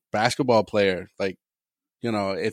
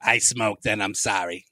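low, slow smoker rasp-free lines; a lightly voiced ex-smoker confessing; mock smoker voice; 5 semitones lower, 4 dB quieter, slower than usual. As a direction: play an old smoker from an ad. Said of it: "I sound like a smoker".

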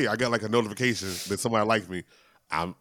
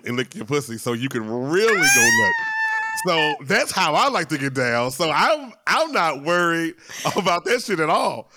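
loud; 8 semitones higher, 5 dB louder than usual. Real, not acted. Shouted boast, +5 dB.

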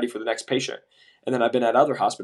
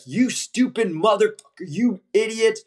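high, furious berating shout; imitating an enraged scrub tech; angry yell; 8 semitones higher, slower than usual. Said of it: "and he's like".

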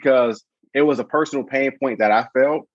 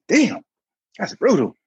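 mock stung reaction; 10 semitones higher than usual. Reacting to brush-off.

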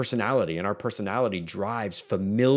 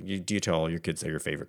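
ordinary talk, nothing marked

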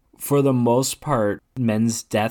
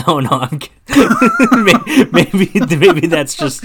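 cracking up while talking, higher and louder; laughing; 7 semitones higher, 9 dB louder, slower than usual. Real, not acted. Speaker admits they are only kidding, cracking up. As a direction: laugh through the words, stumbling over them. Joking, cracks up at own joke.

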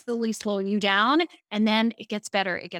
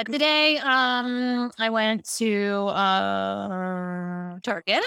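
filler words; much slower than usual. Shown as a demonstration. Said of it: "For me?".

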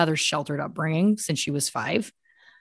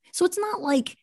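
pitched way up in disbelief, slowing down at the end; incredulous; 9 semitones higher than usual. Real, not acted. Host outraged that music earns a prison, incredulous and high. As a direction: squeak out the injustice.

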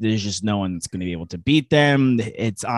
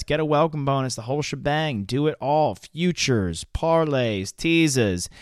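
upbeat sponsor read; 3 semitones higher than usual. Acted. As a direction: pitch it like a radio ad. Sponsor read for betting site.

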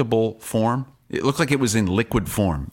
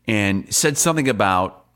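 ordinary talk

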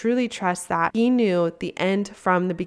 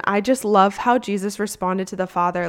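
normal talking voice

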